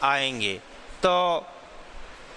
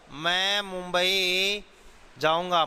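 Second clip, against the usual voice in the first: मैं Mumbai said very slowly, each word drawn out; stress on मैं Mumbai; slower than usual. Shown as a demonstration. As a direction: lean on मैं and slow right down. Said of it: "ये किसी शब्द विशेष पर बल या जोर प्रदान". (मैं मुंबई पर ज़ोर, बहुत धीरे)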